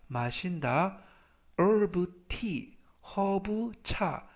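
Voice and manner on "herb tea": ordinary talk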